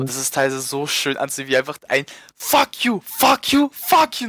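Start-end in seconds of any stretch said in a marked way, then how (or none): 2.3-4.3 mock yelling. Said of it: "anbrüllt".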